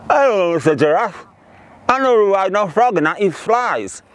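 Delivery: joke-telling voice of a wide-mouthed frog; exaggerated wide-mouthed frog greeting. Frog proudly introduces himself to a monkey, wide-mouthed frog voice.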